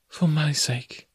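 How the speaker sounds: soft tender plea. A narrator's reading. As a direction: gently beg him to stop. Jane softly pleading with Tarzan.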